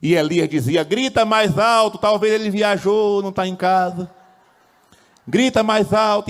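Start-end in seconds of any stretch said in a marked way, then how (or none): 0.8-4.1 high, raised taunting call; mock taunt. Elias taunting Baal's prophets, acted. 5.3-6.3 high, raised taunting call; mock taunt. Elias taunting Baal's prophets, acted.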